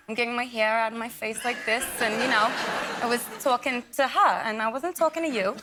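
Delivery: sassy Brooklyn accent; demonstrating a mouthy Brooklyn accent; Brooklyn accent with attitude. Shown as a demonstration. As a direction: put on Brooklyn with plenty of attitude. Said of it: "this attitude came out of nowhere".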